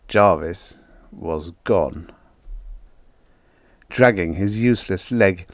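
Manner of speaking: usual speaking voice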